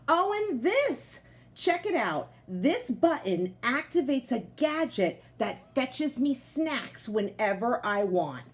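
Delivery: lively picture-book voice for the potato; reading to children. A narrator's reading. Teacher reading a picture book aloud to her class, lively storytelling voice. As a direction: tell it like a proud, cozy couch potato.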